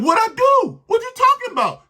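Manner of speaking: high, loud protesting squeal; mock innocent player. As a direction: play the guilty cornerback crying innocence. Acting the player denying the foul.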